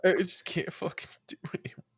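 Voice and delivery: fast, whispered pretend breakdown; mock tearful whisper. Co-host joins the imagined YouTube breakdown, mock tearful whisper. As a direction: whisper it like you're pretending to fall apart. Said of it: "like, crying and you're just like".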